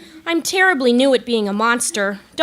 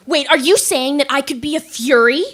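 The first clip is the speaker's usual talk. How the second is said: usual speaking voice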